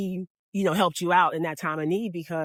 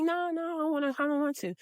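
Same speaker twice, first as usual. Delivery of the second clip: reluctant refusal; 7 semitones higher, 7 dB quieter, faster than usual. Retold or imitated. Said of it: "she's like".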